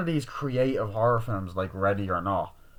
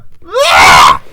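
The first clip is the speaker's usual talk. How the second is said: very loud, drawn-out mock scream; hammed-up scream; 6 semitones lower, 21 dB louder than usual. Reviewer acts out grief over David, hammed-up scream. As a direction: let out an over-the-top scream. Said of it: "I have to do that".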